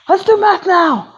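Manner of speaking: eager. Acted. Class eager for math.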